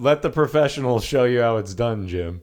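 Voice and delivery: loud, grand theatrical voice; pompous put-on voice. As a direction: play a smug professional actor. Mock superior, put-on voice.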